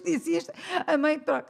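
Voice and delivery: ordinary talk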